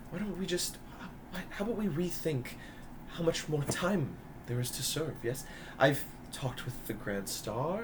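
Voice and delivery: soft, hushed motherly persuasion; a scheming mother easing her son into a deal; mother coaxing her son. Acted. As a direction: GM voicing a mother who pleads softly with her son. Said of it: "As she goes".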